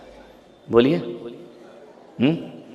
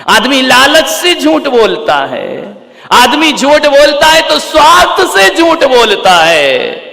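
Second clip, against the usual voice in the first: full-force preaching; 12 semitones higher, 8 dB louder than usual. Real, not acted. Preacher warning the congregation why people lie, full-force preaching. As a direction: thunder it out to the congregation.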